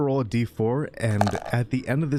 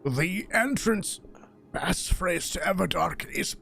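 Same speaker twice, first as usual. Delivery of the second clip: overacted death scene; 8 semitones higher than usual. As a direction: breathe out dying last words. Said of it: "He's being dramatic".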